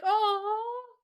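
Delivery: high, gushing coo; cooing aww. Retold or imitated. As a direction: coo over something adorable. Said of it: "And I was like".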